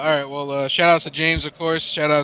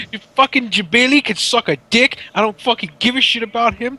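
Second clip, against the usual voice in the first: higher-pitched mock rant; going off; 5 semitones higher than usual. Retelling a player's tirade about Evo, higher mock-angry rant. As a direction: go off dismissively, imitating an irate player. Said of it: "he's going off".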